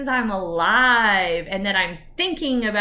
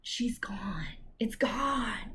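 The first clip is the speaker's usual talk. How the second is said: whispered; 8 dB quieter, much slower than usual. Real, not acted. Relief after inner voice gone.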